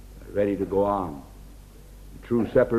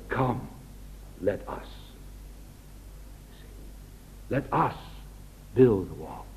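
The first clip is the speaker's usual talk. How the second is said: softer, lower, inviting them in; a soft, humble call to build together; humble, gentle invitation; 6 semitones lower, 14 dB quieter than usual. Retold or imitated. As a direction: lay the words down gently, humble. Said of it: "a man of God doesn't talk like that".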